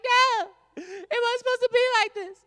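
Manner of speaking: very high, loud, wailing mock lament; high mocking crying voice. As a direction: squeal it like a sobbing liberal.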